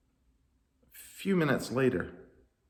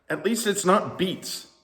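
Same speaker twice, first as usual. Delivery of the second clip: high, slow voice; 9 semitones higher, slower than usual. Acted. Wise person remark.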